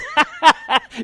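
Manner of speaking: high jeering cackle; mocking laugh. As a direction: laugh in her face. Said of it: "This young man's laughing".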